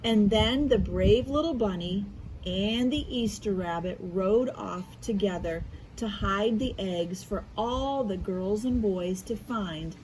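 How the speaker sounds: ordinary delivery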